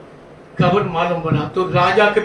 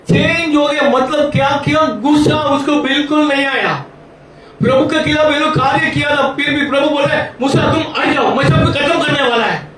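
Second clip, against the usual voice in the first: full-force preaching; 8 semitones higher than usual. Acted. Preacher retells the quarrel with Moses to congregation, forceful preaching. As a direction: drive the story home to the congregation.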